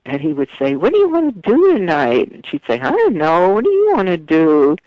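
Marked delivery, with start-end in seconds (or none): 0.8-2.3 high, whiny sketch character; exaggerated whiny impression; imitated whining. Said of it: "the couple was the whiners". 2.8-4.8 whiny sketch-comedy wife; exaggerated bored whine; imitated whining. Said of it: "the couple was the whiners".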